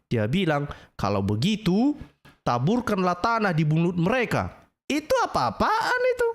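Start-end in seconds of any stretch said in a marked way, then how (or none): 4.9-6.3 high, laughing scoff at the quote; laughing in disbelief. Real, not acted. Preacher laughing at the hadith he just read. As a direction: laugh it off as absurd.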